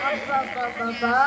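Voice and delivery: low, drawn-out mouth-watering "abbabbabba"; savouring sigh. Acted. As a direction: relish the taste slowly. Savouring imagined food. (నోరూరే ఆస్వాదన)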